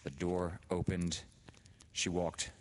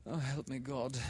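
a touch of nervous prayer; 9 semitones higher than usual. A narrator's reading. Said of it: "She actually said".

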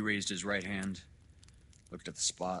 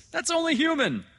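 very high, loud, hearty doctor; booming agreement; 14 dB louder than usual. Acted. Doctor humouring the father, booming and high. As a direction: exclaim it high and big.

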